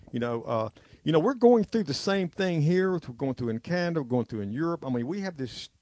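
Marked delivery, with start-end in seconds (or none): none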